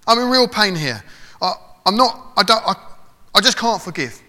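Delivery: loud, high, pleading cry to God; desperate prayer. Pretend. Preacher modelling a raw prayer to God, loud and pleading. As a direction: pour it out loud like someone at the end of their rope.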